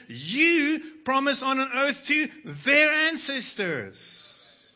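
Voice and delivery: loud, high, exasperated complaint to God; lamenting outburst. Acted. Moses pouring out his burden to God, loud and high. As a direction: pour it all out, near shouting. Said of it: "he's lamenting, he's pouring it all out".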